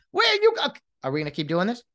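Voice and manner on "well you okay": very high, louder mock objection; hammed-up squawk of objection; sputtering protest. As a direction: start protesting and get cut off. Next protester cut off.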